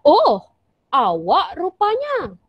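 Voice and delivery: a surprised, drawn-out "Oh, awak rupanya"; terkejut. Shown as a demonstration. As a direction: gasp "Oh" then slow, fall on "rupanya". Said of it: "itu adalah intonasi yang tepat". (terkejut, dengan intonasi yang tepat)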